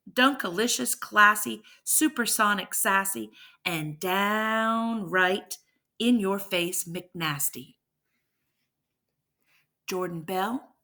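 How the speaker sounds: ordinary delivery, nothing marked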